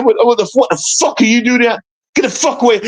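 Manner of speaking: high-pitched, indignant retold outburst; angry outburst. Waking patient telling a police officer to back off, angry.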